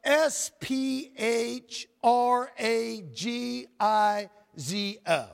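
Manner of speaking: slow, letter by letter; deliberate spelling with each letter separate; spelled out. Shown as a demonstration. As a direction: spell it out for the congregation, one letter at a time. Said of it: "It's spelled".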